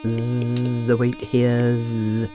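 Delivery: low buzzing bee, zzz between the words. A narrator's reading. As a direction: buzz like a sleepy bumblebee. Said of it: "he buzzed".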